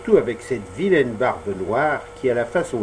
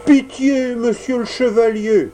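very high begging cry; pleading; 10 semitones higher, 4 dB louder than usual. Acted. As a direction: beg even harder. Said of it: "voix suppliante".

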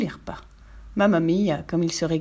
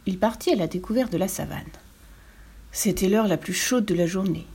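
ordinary delivery

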